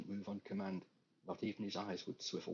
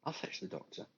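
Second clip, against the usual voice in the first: matter-of-fact nurse; 8 semitones higher than usual. Acted. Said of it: "matter-of-fact manner that only nurses use".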